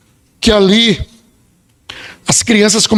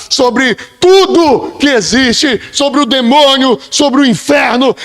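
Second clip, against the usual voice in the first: usual speaking voice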